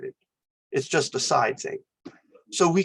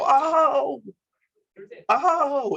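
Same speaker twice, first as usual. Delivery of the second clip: mock pain cry; 11 semitones higher than usual. Shown as a demonstration. Teacher acting out a stubbed toe cry. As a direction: yelp like you just stubbed your toe. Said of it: "I stub my toe and I go".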